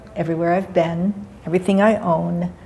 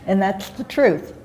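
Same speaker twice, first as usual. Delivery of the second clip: ordinary delivery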